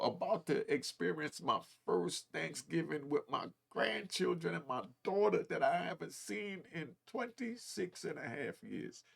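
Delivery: ordinary talk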